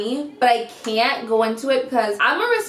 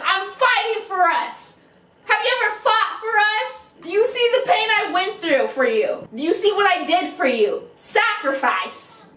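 higher, dramatic possessive rant; ranting girlfriend; 7 semitones higher than usual. Acted. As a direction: lay on the devotion theatrically.

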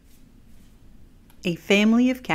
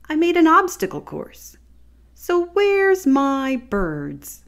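put-on high, loud, indignant kid voice, slowing at the end; indignant child; 10 semitones higher, 5 dB louder, slower than usual. Child complaining to his mother, high and loud. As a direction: demand where the birds are, loud and high.

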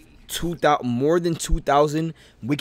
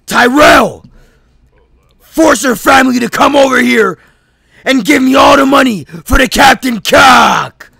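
loud, higher shouting kidnapper; villain demand; 8 semitones higher, 13 dB louder than usual. Acted. Kidnapper yelling at Tyrell to pay ransom, loud and menacing. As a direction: bark the ransom demand at the captive. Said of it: "I'm in my actor bag right now".

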